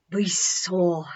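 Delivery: a hushed whisper of wonder; whispered awe. A narrator's reading. Salome recalling the moment they knew Jesus, whispering. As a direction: let it fall to a whisper. Awed climax of the story.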